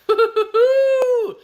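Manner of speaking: high, loud burst of laughter; squealing laugh. Real, not acted. Streamer cracks up at a chat joke, shrieking laugh.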